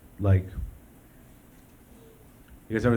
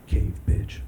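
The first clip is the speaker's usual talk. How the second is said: wistful whisper; 3 dB quieter, slower than usual. Retold or imitated. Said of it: "and you're like".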